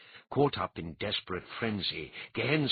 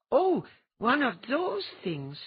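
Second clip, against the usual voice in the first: high, slow, put-on voice; a lofty, dismissive figure; sniffy character voice; 13 semitones higher, 3 dB louder than usual. Character voice in read story.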